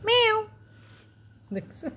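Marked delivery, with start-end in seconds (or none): 0.1-0.4 squeaky playful meow; high cat meow. Real, not acted. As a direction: mew like a kitten. Cat sound for baby. (উঁচু সুরে বিড়ালের ডাক)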